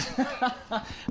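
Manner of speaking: a burst of high laughter; hearty laugh. Real, not acted. Laughs after gloating joke.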